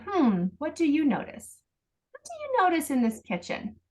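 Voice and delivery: high, playful questioning; playful parent asking the child. Shown as a demonstration. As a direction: tease him into noticing, voice lifted high. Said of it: "In, in a playful way".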